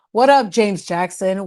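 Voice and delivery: ordinary talk, nothing marked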